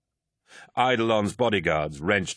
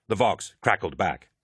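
plain reading voice

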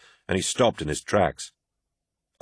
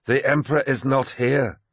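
higher-pitched, blunt statement; 6 semitones higher than usual. A narrator's reading. Targost rebuts Loken about the Emperor, blunt. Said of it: "said Targost bluntly".

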